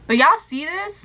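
high, eager question to viewers; excited. Real, not acted. Showing yellowing phone to camera.

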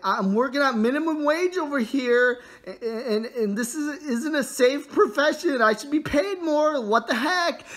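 hammed-up indignant rant, high and loud; mock outraged worker; complaining miner. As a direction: rant at the boss, rising as it builds. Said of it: "he goes over to the boss and he's like".

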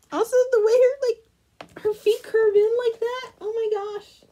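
excited gushing. Real, not acted. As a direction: squeal over how cute it is. Gushing over cute doll.

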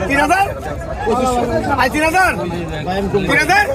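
high, loud drawn-out bid calls; auctioneer. Real, not acted. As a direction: stretch the bids out, calling.

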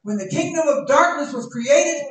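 loud, high, stretched preacher cry; climbing sermon fervor; full-force preaching. Acted. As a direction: preach it out to the whole church.

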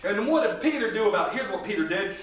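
ordinary talk, nothing marked

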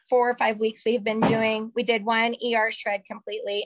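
ordinary delivery

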